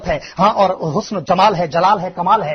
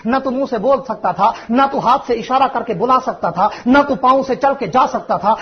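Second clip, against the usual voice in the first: ordinary talk, nothing marked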